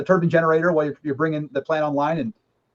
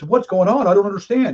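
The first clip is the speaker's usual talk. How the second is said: lightly voiced baffled citizen, raised pitch; higher, louder, puzzled protest; mock bewilderment; 6 semitones higher, 5 dB louder than usual. Average American baffled by the wars, mock bewildered.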